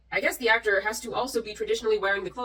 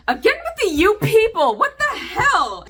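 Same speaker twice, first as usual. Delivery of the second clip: much higher and louder, incredulous; exasperated outburst; 10 semitones higher, 7 dB louder than usual. Real, not acted. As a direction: blow up at the phrase. Exasperated at phrase "you people.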